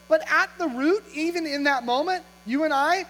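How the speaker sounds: normal talking voice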